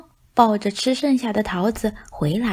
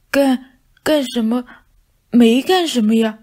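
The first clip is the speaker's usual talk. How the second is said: stammering pout, a bit louder; sulky stutter of a caught cat; 4 semitones higher, 6 dB louder than usual. Acted. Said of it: "大肥猫撅着嘴说". (大肥猫撅着嘴，结结巴巴地说)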